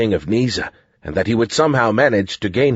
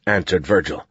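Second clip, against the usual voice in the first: plain reading voice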